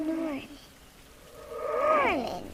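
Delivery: put-on ghostly old woman's drawn-out call; creepy old woman. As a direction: call his name like a spooky old mother. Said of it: "A creepy old woman".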